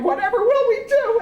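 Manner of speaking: high, loud mock wail; hammed-up panicked wail; cried out. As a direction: cry out in childish despair.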